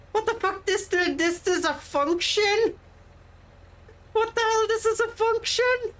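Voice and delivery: high-pitched real disbelief; can't believe what was just found; incredulous. Real, not acted. Player discovering a game function too late, incredulous.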